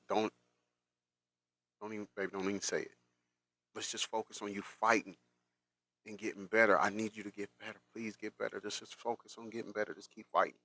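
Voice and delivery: tender hush for someone very ill; gentle, faint plea at a hospital bed; soft bedside plea. Retold or imitated. Retelling bedside words to dying partner.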